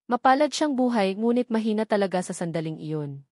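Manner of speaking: ordinary delivery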